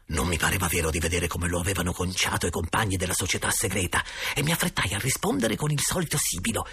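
ordinary delivery

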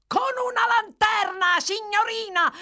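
high, loud far-carrying yell; shouting to carry. A narrator's reading. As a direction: call out as if through cupped hands. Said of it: "gridò Brittles, portando entrambe le mani ai lati della bocca".

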